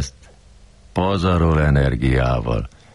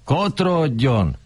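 higher, slow get-out bark; mock order out; 10 semitones higher, slower than usual. Kurilla throwing the narrator out, slow mock bark. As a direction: drag out the dismissal. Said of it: "ordította".

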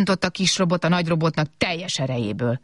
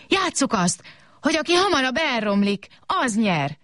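high-pitched, delighted proposal; happy exclamation; 8 semitones higher than usual. A narrator's reading. Small robot proposing a new game, happy exclamation. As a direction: burst out happily with a new idea. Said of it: "kiáltott fel egyszerre boldogan".

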